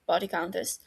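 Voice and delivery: plain reading voice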